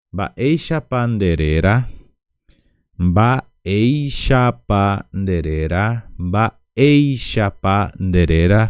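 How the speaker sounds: slow, loud, rising Guaraní drill of "Baeisha Panderera"; slow model reading. Shown as a demonstration. Teacher reads the question aloud for students, slow and loud. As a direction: sound out "Baeisha Panderera" slowly for the class. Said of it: "Entonces vamos a leerlo".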